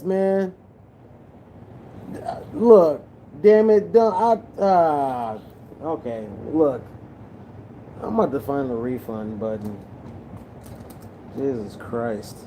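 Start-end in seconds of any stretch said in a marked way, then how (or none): none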